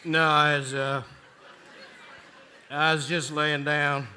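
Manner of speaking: slow, loud, thick sleepy phone voice; half-asleep, covering up with slow excuses; groggy denial. Acted. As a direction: drag the words out as if just woken. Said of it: "'cause what do they say?".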